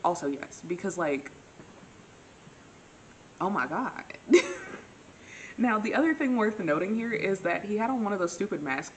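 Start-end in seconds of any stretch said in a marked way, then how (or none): 4.1-4.8 high-pitched squeal of laughter. Real, not acted. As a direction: burst out in a high, loud laugh. Laughs at her own story.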